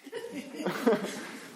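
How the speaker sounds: high giggling laugh. Real, not acted. Preacher laughing at his own impression of the guy. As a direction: burst out laughing at the joke. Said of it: "laughing at own impression".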